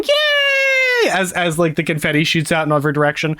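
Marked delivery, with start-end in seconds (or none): 0.0-1.1 high, drawn-out kiddie cheer; children cheering. Retold or imitated. Game master voicing children as confetti flies, high cheer. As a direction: squeal it like a crowd of cheering kids. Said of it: "like, children cheering and clapping".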